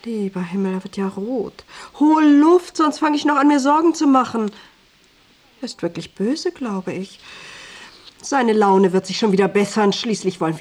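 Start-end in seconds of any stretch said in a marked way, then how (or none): 2.0-4.5 raised, loud and higher scolding; loud fussing command; exasperated order. Acted. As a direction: order him to breathe, voice pushed up. Scolds man turning red.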